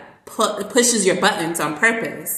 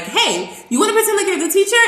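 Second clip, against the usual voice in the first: high, quick, eager invitation; excited pitch; 12 semitones higher, 4 dB louder, faster than usual. Retold or imitated. Teacher inviting kids to swap roles, excited. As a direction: pitch it fast and high, like a fun new game.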